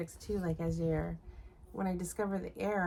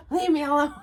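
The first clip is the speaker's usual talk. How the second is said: a higher, much louder hammed-up snap; mock yell; 7 semitones higher, 12 dB louder than usual. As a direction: yell it like a cranky knitter, half joking. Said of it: "I'm like".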